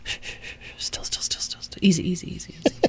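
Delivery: soft, calming whisper. Pretend. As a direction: hush them gently, barely voiced. Hushing someone to stay still.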